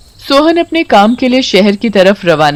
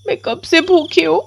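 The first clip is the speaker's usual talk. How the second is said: put-on high child voice, softer and aggrieved; hurt little girl; 9 semitones higher, 4 dB quieter than usual. Komal tells the witch how her mother shuts her out. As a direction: complain quietly like a wronged child.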